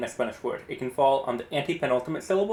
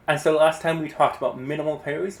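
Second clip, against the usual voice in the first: ordinary talk, nothing marked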